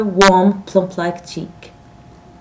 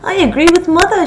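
high, loud, quick triumph; triumphant cry; 11 semitones higher, 6 dB louder, faster than usual. A narrator's reading. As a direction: crow your victory brightly. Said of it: "she cried triumphantly".

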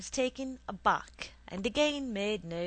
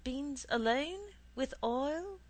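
put-on high boy's protest, questions rising; 7 semitones higher than usual. A narrator's reading. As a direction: protest to mother, pitched up high. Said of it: "I protested".

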